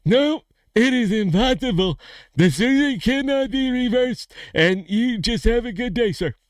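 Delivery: punchline doctor squeaking now; doctor answers in a high squeaky voice. Acted. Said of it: "doctor looked at the guy and says".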